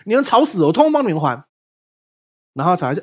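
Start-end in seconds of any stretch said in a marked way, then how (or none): 0.1-1.4 fed-up outburst. Retold or imitated. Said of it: "拍桌子说".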